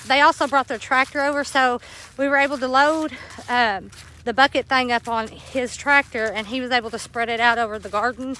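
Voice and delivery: ordinary talk, nothing marked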